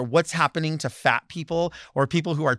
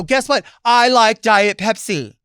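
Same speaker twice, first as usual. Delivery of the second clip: high, loud, slow proud statement; loud defiant announcement; bold declaration; 6 semitones higher, 9 dB louder, slower than usual. Pretend. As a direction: stand up and announce it. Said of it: "Stand up and go".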